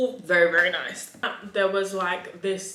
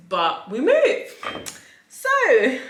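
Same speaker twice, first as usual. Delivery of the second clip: high, loud sassy brush-off; 6 semitones higher, 11 dB louder, much slower than usual. Acted. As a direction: toss it off with diva sass. Sassy catchphrase dismissal.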